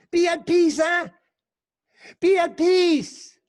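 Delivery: high, loud, slowed Be at peace; soothing blessing. Retold or imitated. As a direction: bless her to calm down. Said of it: "And he say".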